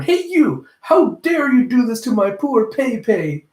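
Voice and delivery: high, loud indignant scolding; hammed-up fury on behalf of a loved one; mock angry outburst. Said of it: "getting mad".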